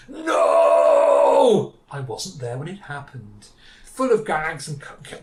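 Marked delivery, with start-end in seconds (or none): 0.1-1.7 long, drawn-out mock-dramatic shout; anguished cry. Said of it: "she goes". 1.9-3.5 slow, soft mock lament; mock regret. Minnie lamenting she missed it.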